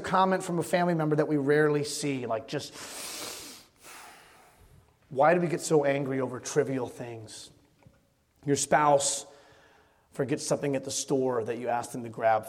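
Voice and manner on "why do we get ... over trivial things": normal talking voice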